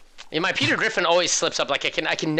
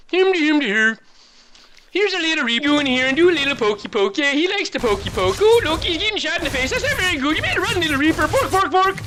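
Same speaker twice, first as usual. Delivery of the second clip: much higher, louder gibberish cartoon chef voice; Swedish Chef voice; 11 semitones higher, 4 dB louder than usual. Caster narrating the Reaper in a goofy chef voice. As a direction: babble the play-by-play like a silly cartoon chef. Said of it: "it's like the Swedish chef".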